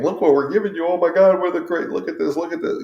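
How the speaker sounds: high-pitched mock gushing over everything; gushing excitement. Owner showing a new dog everything, gushing excitedly. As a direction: overwhelm the dog with eager, high chatter.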